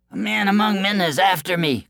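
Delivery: high-pitched cry of a fleeing braggart; crying-out boaster. A narrator's reading. Boaster tells the giant who chases him, high cry. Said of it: "cried the boaster".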